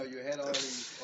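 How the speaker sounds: usual speaking voice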